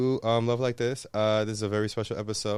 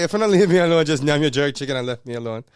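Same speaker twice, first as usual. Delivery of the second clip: loud patois telling-off, easing down; fed up in Jamaican patois; exasperated Jamaican patois; 5 semitones higher, 6 dB louder, faster than usual. Retold or imitated. Host telling off pestering friends, exasperated Jamaican patois. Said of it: "I'm like".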